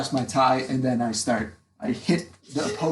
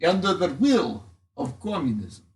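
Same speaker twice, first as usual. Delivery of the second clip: put-on lightly done Russian accent speech; rally the crowd in a Russian accent; 4 semitones higher than usual. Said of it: "I have a Russian accent".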